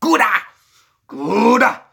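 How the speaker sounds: "Gouda" with an exaggerated strong G, high and loud. Shown as a demonstration. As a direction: blast out Gouda from a hard G. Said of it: "with a strong G".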